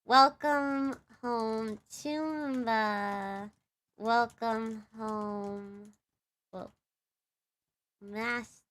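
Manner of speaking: slow, hushed greeting with drawn-out words; gentle, lingering welcome-home. Shown as a demonstration. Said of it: "I could also do, like".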